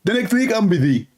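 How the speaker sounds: high mock voice of a dodging debater; mocking Zakir Naik. Mocking the debater who keeps dodging, high and dismissive.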